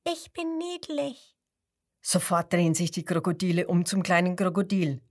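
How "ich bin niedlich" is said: high, soft squeaky voice; tiny high squeak of a baby crocodile; little crocodile squeaking. A narrator's reading. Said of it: "Das fünfte quiekt".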